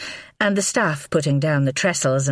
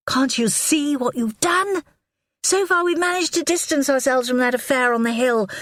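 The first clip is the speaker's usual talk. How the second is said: furious wife; 12 semitones higher than usual. A narrator's reading. Wife berates husband.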